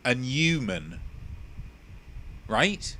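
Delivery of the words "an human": human said H-less, linked as "an yuman"; dropped H. Shown as a demonstration. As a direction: run the article into an H-less human. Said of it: "with the H being omitted entirely".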